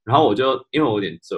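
ordinary delivery

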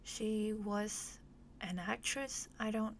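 usual speaking voice

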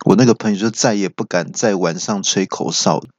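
normal talking voice

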